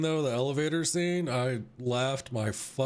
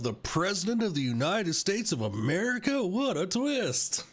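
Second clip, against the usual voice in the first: sarcastic; 6 semitones higher than usual. Real, not acted. Sarcastic about plot twist.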